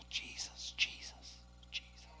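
breathy whispered name, barely audible; hushed prayerful whisper; whispered Jesus. Real, not acted. Whispered prayer between sermon points.

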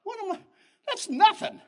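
high, loud scoffing reply; defiant retort. Retold or imitated. [name] answering the bully, scoffing. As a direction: brush the bully off, rising to loud. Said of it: "I go".

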